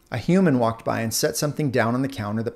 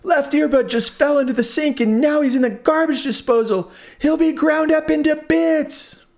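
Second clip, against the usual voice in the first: much higher, loud panicked rush; frantic; 6 dB louder than usual. Acted. Earbud tells of twin in the disposal, frantic. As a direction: plead in terror for the twin. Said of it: "even more frantic".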